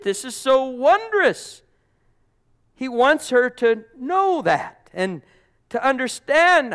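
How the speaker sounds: high, loud, impassioned proclamation; full-force preaching. Acted. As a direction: cry it out slowly, then press on at full voice.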